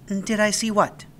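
a classmate shrugging him off; girl Natalie. A narrator's reading. Girl friend answers puzzled.

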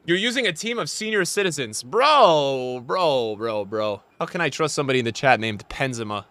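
ordinary talk, nothing marked

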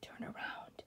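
hushed warning whisper. Acted. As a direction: whisper his name in fear. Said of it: "she whispered".

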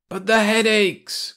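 high, slow, loud complaint; crying out. Acted. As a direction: protest it loudly. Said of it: "cried the old man".

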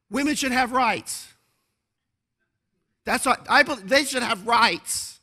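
high, slow mock protester voice; a hammed-up clueless marcher. Pastor mocking a marcher's answer, high slow voice. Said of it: "People were interviewed in the first march".

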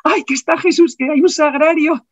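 high, bewildered imitation of him; dazed husband. Husband kneeling in the chapel, dazed and high-pitched. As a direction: echo her words in awed confusion. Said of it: "el pobre, todo aturdido".

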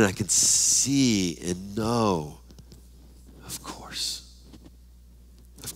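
slow, soft whisper of sudden understanding; awed whisper. Pretend. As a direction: let the words fall out in hushed wonder. Acted awe on entering heaven.

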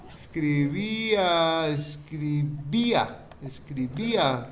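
slow, drawn-out dictation of escribía. Shown as a demonstration. Teacher dictating a word to her pupils, slow and drawn out. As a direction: stretch out "Escribía" syllable by syllable for the class. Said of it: "tilde en la I".

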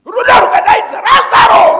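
preacher's shout. Real, not acted. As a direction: call Lazarus out of the tomb. Preacher shouts Lazarus call.

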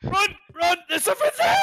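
panicked high shouts while fleeing; being chased. Real, not acted. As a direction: yell to run, scared and laughing. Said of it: "that literally made my heart skip a beat".

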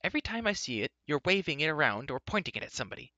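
high-pitched, exasperated scolding; fussing crossly at a companion; indignant Elodie. A narrator's reading.

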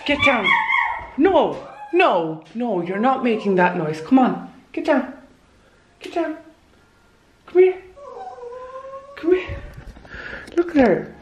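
high coaxing commands to a dog; high firm calls to the puppy; pet scolding. Real, not acted. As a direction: coax the dog down, pitched high.

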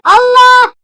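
high, loud cry; startled scream. Real, not acted. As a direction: cry out in sudden fright. Sudden in-game fright. (korkuyla bağırarak)

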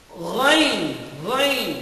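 Ghain gargled at the back of the throat, no hard g; Arabic ghain. Shown as a demonstration. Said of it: "walang letrang ga".

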